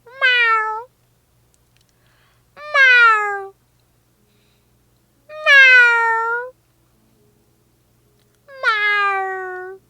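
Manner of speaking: high, drawn-out meows to a cat. Pretend. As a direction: meow like a cat calling another cat. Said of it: "Why is your cat noise deeper".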